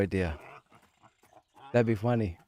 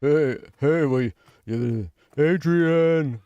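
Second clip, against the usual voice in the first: loud, drawn-out boxer's call; rough attempt at a movie tough guy, louder; Sylvester Stallone; 4 semitones higher, 5 dB louder, much slower than usual. Sore-throated impressionist calling Adrian, Sylvester Stallone. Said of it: "It's my Sylvester Stallone".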